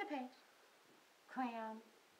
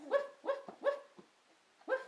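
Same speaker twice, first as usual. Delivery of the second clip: much higher, loud yappy woofs; dog bark; 5 dB louder than usual. Pretend. Parent barks like a puppy for the baby's dog page.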